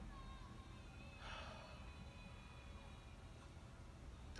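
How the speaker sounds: a long breathy sigh, holding back tears; long shaky sigh. Real, not acted. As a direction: let out a long tearful sigh. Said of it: "I'm getting teary-eyed".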